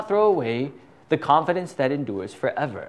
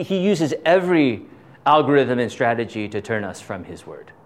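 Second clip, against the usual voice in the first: normal talking voice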